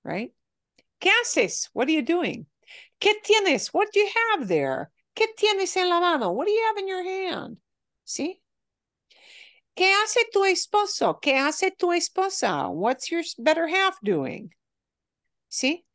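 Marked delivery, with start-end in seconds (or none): 1.0-7.6 Spanish questions modelled up high, lively and quick; questioning demo. Shown as a demonstration. Teacher models Spanish questions for class, high and lively. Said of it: "is just the word que". 9.8-14.5 Spanish questions modelled up high, lively and quick; questioning demo. Shown as a demonstration. Teacher models Spanish questions for class, high and lively. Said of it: "is just the word que".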